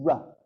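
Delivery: R sound held as a vowel; rhotic R vowel. Shown as a demonstration. Teacher demonstrating the Sanskrit R vowel to the class. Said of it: "Do it".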